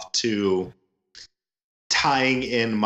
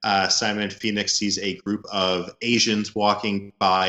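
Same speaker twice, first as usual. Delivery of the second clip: ordinary talk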